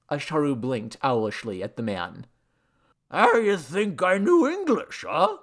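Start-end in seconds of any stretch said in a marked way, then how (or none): 3.1-5.4 slow, high tipsy slur; drunken god. A narrator's reading. Drunk god challenging the man, slurred.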